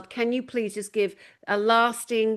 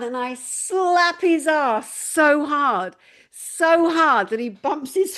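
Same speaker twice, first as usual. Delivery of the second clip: slow, rising, louder story build to the punchline; climbing higher and slower to the twist; building excitement; 6 semitones higher, 4 dB louder, slower than usual. Retold or imitated. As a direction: draw it out toward the reveal.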